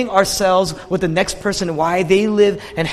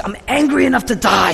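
raised, higher retelling of Jonah's anger; angry outburst; 5 semitones higher, 3 dB louder than usual. Retold or imitated. Preacher acting out Jonah at his angriest, angry. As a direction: voice an angry prophet at his peak. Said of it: "in the peak of his anger".

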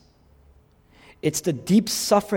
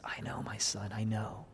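very soft, lowered, grieving voice; almost crying; 4 semitones lower, 13 dB quieter than usual. Acted. Old Jacob reassuring his son, close to tears. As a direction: barely voice it, gently and sadly. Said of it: "He's almost crying".

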